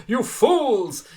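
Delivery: high, loud, hammed-up gloating cry; a pompous know-it-all crowing at everyone; mock villain crowing at the fools; mocking smart arse. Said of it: "the smart arse that thinks he's original".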